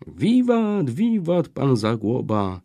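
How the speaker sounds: high, loud cheer; cheering shout. A narrator's reading. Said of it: "krzyknęli tak ogromnym głosem".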